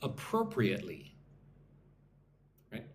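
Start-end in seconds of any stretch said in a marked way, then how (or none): 0.0-1.1 slow model word. Shown as a demonstration. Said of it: "nice and slow".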